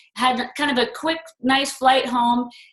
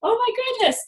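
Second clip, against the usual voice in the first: high, startled whoa; a squeal of awe at who walks in; amazed gasp; 13 semitones higher than usual. Retold or imitated. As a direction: caught off guard by a famous face. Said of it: "And I'm just like".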